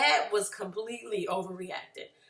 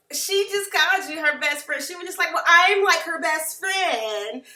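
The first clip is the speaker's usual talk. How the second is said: raised, louder retelling, dragging the last words; mock outrage; 6 semitones higher, 6 dB louder than usual. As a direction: protest it loudly and higher. Mock outrage over being called best friend.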